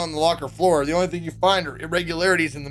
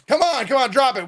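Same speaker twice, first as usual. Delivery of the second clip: higher, louder hurried order; urgent command; 7 semitones higher, 5 dB louder than usual. Acted. Kid urgently orders the others to drop the locker. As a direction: push them to drop it now.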